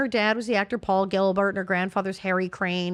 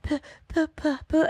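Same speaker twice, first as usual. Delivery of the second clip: a higher, halting stutter on each puh; imitating her stutter; 6 semitones higher than usual. Retelling the girl's stutter in a higher, halting voice. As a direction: sound out the stuck puh puh like a crying child. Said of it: "And she's going".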